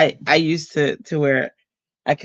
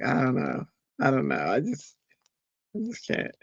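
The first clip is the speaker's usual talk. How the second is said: shy whisper; 7 dB quieter than usual. Retold or imitated. Her teen self, shy.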